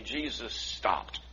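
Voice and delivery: quiet and slow. Shown as a demonstration. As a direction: drop it to a hush. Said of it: "I want you to repeat that to me".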